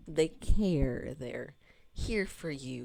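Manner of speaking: ordinary talk, nothing marked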